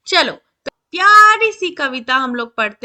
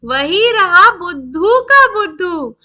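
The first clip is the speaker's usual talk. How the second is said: lilting verse, pitched higher; poem recitation; 4 semitones higher, 6 dB louder than usual. A narrator's reading. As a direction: recite to children with swooping emphasis. (ऊँचे सुर में लयदार कविता-पाठ)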